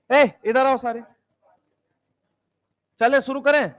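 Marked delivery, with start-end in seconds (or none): none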